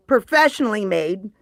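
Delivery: ordinary talk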